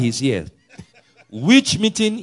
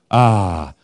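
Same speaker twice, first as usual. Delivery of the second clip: usual speaking voice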